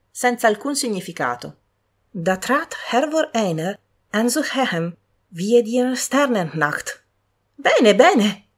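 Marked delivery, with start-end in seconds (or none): 2.1-7.0 slow, solemn German verse; solemn recitation. A narrator's reading. Young woman reciting a German verse, slow and solemn. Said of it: "citerò qui quel passo". 7.6-8.5 loud, high, thrilled; delighted exclaim. A narrator's reading. Young woman thrilled by the verse, loud delighted exclaim. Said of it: "esclamò con gli occhi sfavillanti".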